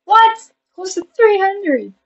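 high, loud disbelief, then drawn out; astonished. Real, not acted. Vlogger seeing nearly three hundred, high-pitched astonishment. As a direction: shoot up high in surprise. Discovering the view count.

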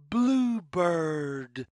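ordinary delivery, nothing marked

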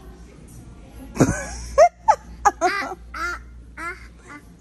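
high, loud, drawn-out laughing fit; burst of laughter. Real, not acted. Mother cracking up at her child while shopping, laughing fit. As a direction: crack up helplessly.

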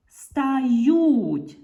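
loud, high, stretched "стають"; emphatic sounding out. Shown as a demonstration. Teacher showing pupils the verb ending, emphatically drawn out. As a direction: hold the vowels of СТАЮТЬ and push the ending.

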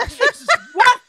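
high, loud laughing what; laughing disbelief. Retold or imitated. Telling how Tim cut their show, laughing disbelief. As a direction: squeal it out in amused shock. Reacting to pay cut news.

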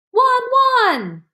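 very high, loud "Wan, wan!" ending in a whimper; a last bark that breaks into a cry; crying dog bark. A narrator's reading. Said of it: "Hachiko barked loudly".

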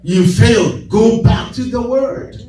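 rising preacher's refrain on go back to the word; call-and-response preaching. Acted. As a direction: drive the refrain home to the congregation.

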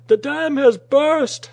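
slow, high, full-volume yell; alarmed shout. A narrator's reading. Saunders warning that the dam burst, panicked shout. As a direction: bellow the alarm, stretching the words. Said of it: "Saunders shouted".

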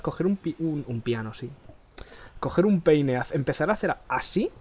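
ordinary delivery

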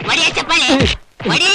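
loud order to clap. Acted. Henchman loudly orders a man to clap for Patel.

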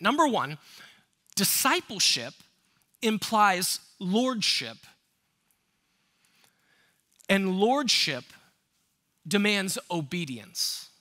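each word hammered out, slow and loud; slow emphatic stress. Real, not acted. Pastor to congregation, slow emphatic stress. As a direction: drive home discipleship, lordship, obedience. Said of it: "emphatic sermon points".

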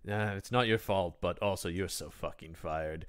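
low, soft, deadpan dismissal; imitating Goth. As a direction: let them down quietly, then fire them. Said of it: "I did want Goth, though, to just be like".

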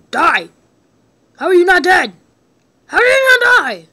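loud, rising yell at an enemy that won't die; frustrated shout. Real, not acted. Gamer yelling at a boss that won't die.

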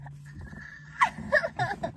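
very high shrieking laugh. Real, not acted.

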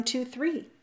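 ordinary delivery

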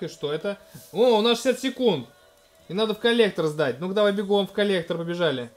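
ordinary talk, nothing marked

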